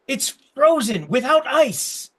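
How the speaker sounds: high, drawn-out gnome voice; excited gnome. Acted. Gnome offers a treat to visitors, high excited voice. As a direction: sell it slowly in a high gnome voice. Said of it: "The gnomes have created".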